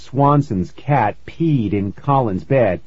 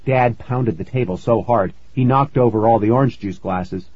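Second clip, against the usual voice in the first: usual speaking voice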